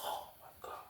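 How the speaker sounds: whispered stunned aside; shocked whisper. Retold or imitated. Hearing the confession, stunned whisper. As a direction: breathe it out in disbelief.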